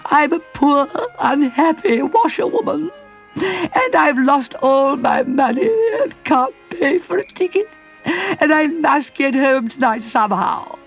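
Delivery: high, slow, weepy washerwoman voice; crying Toad. A narrator's reading. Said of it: "crying again".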